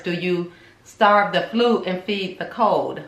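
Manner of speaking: ordinary talk, nothing marked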